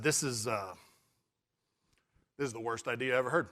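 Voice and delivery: understated dread, dropping low and soft; deflated verdict. Retold or imitated. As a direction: sink into resigned disbelief. Retelling his dismayed verdict on idea.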